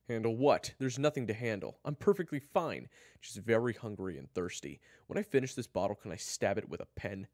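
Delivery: loud, high irritated outburst; angry denial. Acted. Kyler insists he is fine while fuming, angry and loud. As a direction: insist heatedly, far too loud. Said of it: "Kyler only growled in reply".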